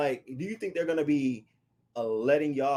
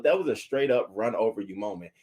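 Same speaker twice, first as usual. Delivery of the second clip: ordinary talk, nothing marked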